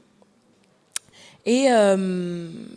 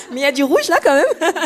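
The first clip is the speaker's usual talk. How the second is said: rire franc, voix plus aiguë et plus forte; le dire en éclatant de rire; en riant; 5 semitones higher, 10 dB louder than usual. Real, not acted. Rit de sa tenue. (elle montre son rouge en riant)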